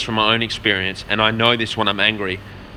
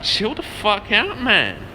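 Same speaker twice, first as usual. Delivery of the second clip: high, slow drawn-out plea to calm down; slow high-pitched chill the fuck out; 10 semitones higher, much slower than usual. Retold or imitated. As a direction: stretch it out high, half-pleading. Telling grandmas to calm down.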